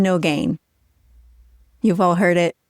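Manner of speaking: normal talking voice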